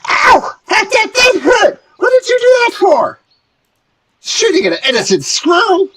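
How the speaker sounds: very high, loud squealing gnome in pain; a tiny gnome shrieking in hurt outrage; outraged gnome. Acted. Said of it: "he says".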